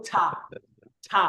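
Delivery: "ছা" released on a burst of air; aspirated consonant. Shown as a demonstration. Teacher drills a Korean word with students, aspirated "ছা". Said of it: "বাতাসের জাপটাটা বেশি বের করবেন".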